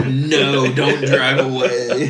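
lightly voiced drawn-out plea; slow, playful wail of the thing left behind; pleading creature.

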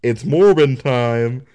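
mock-confident shout to a star; brash confidence. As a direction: imitate an overconfident fan calling out. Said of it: "walked up with such confidence".

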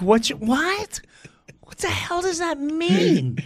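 high-pitched bewildered outburst; totally thrown, pitch shooting up; baffled. Retold or imitated. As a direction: voice climbing in comic disbelief. Baffled reaction to wife's advice.

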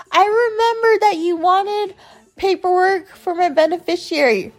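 high, put-on voice for her; mocking imitation. Woman retelling her text about paperwork, high mocking imitation.

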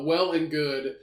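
ordinary talk, nothing marked

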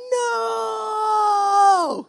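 long, high, loud mock wail of NO; hammed-up drawn-out howl of despair; frustrated scream. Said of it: "And you're frustrated".

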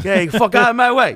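loud, high, brash New York snarl; New York tough guy. Retold or imitated. Comic telling a podcast host how New Yorkers don't talk, loud New York accent. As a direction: bark it like a pushy New Yorker. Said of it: "people from New York who don't fucking talk like".

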